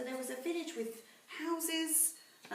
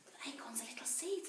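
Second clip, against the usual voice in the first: quick hushed explanation; whispered aside; 6 dB quieter than usual. Real, not acted. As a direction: whisper it to the class.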